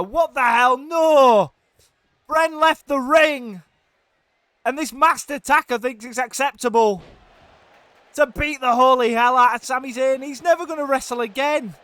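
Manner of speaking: high, loud outraged yelling; outraged commentator. Real, not acted. Commentator yelling as Master Taka attacks Sami, outraged. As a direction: shout in disbelief at the interloper.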